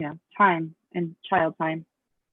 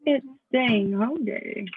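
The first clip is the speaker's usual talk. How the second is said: slowed right down, higher; slow and clear; 4 semitones higher, much slower than usual. Real, not acted.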